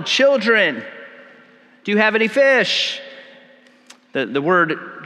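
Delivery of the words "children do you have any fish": raised, higher-pitched call to men offshore. Retold or imitated. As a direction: call out as if across the water, without a full shout. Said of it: "Jesus yells out to them".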